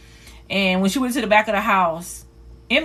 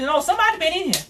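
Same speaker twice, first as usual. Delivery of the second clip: higher, not buying the denial; insistent grandma; 8 semitones higher, faster than usual. Retold or imitated. Grandma insisting someone was in her room, higher. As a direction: shut down the excuse. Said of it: "She said".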